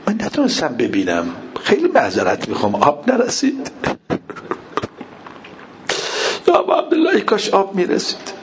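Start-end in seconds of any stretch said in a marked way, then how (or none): 2.7-8.3 high-pitched wail of grief; tearful lament. Real, not acted. Preacher mourning Abbas before the congregation, tearful lament. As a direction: cry it out, voice climbing sharply.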